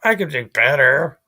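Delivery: hammed-up impression of an unimpressed guy; mimicking someone's reply. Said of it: "well, he's like".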